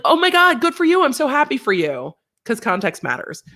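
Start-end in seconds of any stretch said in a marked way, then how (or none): 0.0-2.1 higher, eager happy gush; bright, sincere congratulations; genuine enthusiasm. Shown as a demonstration. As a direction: sound truly happy for them. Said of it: "I guess if you're like".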